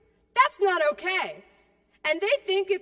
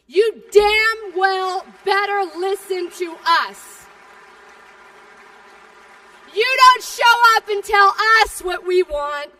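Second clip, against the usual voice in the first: rally shout; 4 semitones higher, 10 dB louder than usual. Real, not acted. Union speaker shouting at politicians, furious rally roar. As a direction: yell the demands at the politicians.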